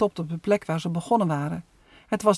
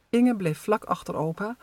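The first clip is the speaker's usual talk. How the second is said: plain reading voice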